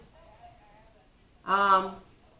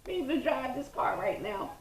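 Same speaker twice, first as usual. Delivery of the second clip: high, laughing mock plea for help; 11 semitones higher than usual. Real, not acted. Swooning over partner's good looks, laughing. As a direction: joke through bursts of laughter, pitched way up. Jokes through laughter about the attraction.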